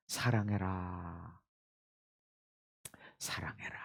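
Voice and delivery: low, slow, fading to a whisper; God's quiet voice. Retold or imitated. God answering the preacher's prayer, hushed whisper. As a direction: let God's word sink in softly.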